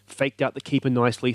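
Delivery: normal talking voice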